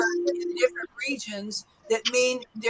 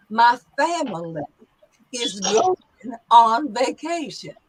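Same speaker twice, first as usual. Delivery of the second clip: Southern accent. Shown as a demonstration. Teacher demonstrates again for students, Southern accent. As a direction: draw out each phrase, Southern style. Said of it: "Notice the long vowels".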